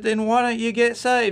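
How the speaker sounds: put-on high, insistent kid-sister voice; mock big sister. Sister pressing her little brother, in a high kid voice. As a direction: pitch it way up like a pushy seven-year-old. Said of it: "And she said".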